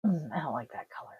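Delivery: fast unvoiced whisper to herself; whispered aside. Real, not acted. Streamer tweaking colour settings, fast whispered aside. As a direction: mutter it under your breath, quickly. Muttering while adjusting stream.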